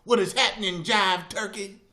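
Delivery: high, loud, drawn-out jive greeting; loud caricature of retro street slang; old-time jive talker. Retold or imitated. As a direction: imitate an outdated slang-slinger, hammed up.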